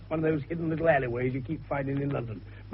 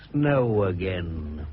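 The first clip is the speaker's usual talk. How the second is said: ordinary delivery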